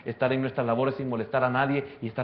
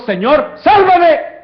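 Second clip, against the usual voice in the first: screamed, much higher and louder plea; desperate cry for help; 17 dB louder, much slower than usual. Acted. Peter sinking in the waves cries to the Lord, screaming. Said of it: "él solo dijo".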